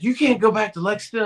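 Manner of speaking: sharp high yell at a player; mock coach yelling "What are you doing?"; retold coach yelling. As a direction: shout it like an angry coach. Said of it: "my coach yelled and cussed".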